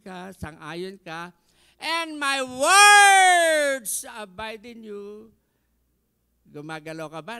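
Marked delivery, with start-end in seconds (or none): 1.8-5.3 very loud, drawn-out, high proclamation; preacher's soaring climax; preaching at full force. Acted. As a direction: stretch it out and shout. Scripture climax.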